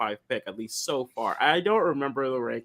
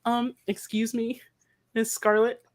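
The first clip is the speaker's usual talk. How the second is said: high, slow timid nerdy voice; a much higher, hesitant mock suitor; dorky suitor voice; 5 semitones higher than usual. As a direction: ask shyly like a nervous dork. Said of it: "hundreds of cast members going".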